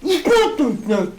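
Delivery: laughing loud outcry at the ignite; laughing shout. Real, not acted. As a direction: yell it out while laughing.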